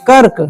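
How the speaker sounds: "कर्क" weighted on KA, r short. Shown as a demonstration. Teacher weighing the sounds for class, "कर्क" with light r. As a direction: lean on KA in "कर्क", pass quickly over r. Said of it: "रो का कम उच्चारण".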